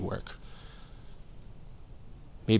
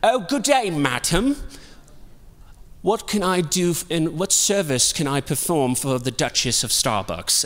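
mock British accent, higher-pitched and fawning; 5 semitones higher than usual. Baristas flattering the visiting district manager in a mock British accent. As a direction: put on an over-polite British servant's accent. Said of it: "everyone developed this British accent".

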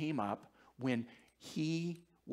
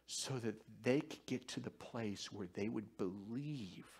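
hushed solemn; 8 dB quieter than usual. Real, not acted. Solemn climax of sermon point.

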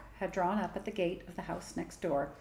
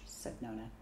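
ordinary delivery

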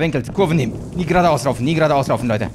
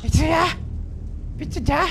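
much higher, timid calling out; mock scared calling; 13 semitones higher than usual. Gamer calling to the hidden monster, mock-frightened high voice. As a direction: call into the dark like a frightened kid.

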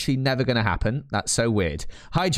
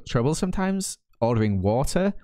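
ordinary delivery

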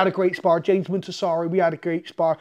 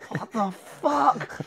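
baffled; 5 semitones higher, 3 dB quieter, much slower than usual. Retold or imitated. His baffled reaction, slow.

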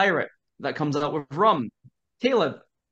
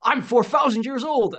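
ancient Viking; 9 semitones higher than usual. Retold or imitated. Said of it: "And he said".

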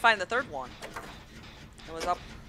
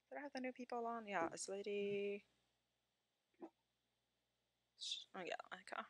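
whispering; 19 dB quieter than usual. Real, not acted. Quiet aside on stream.

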